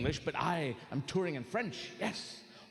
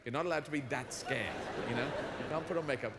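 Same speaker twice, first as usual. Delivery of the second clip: usual speaking voice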